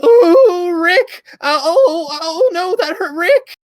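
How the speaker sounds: very high crying shout; mock Morty scream. Husband hamming a pained scream while wife pops pimples. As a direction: wail it like a panicked cartoon boy. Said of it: "doing an impression of Morty screaming".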